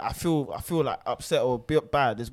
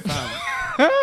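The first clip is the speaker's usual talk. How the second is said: laughing reply; 12 semitones higher, 6 dB louder than usual. Retold or imitated. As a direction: say it through laughter.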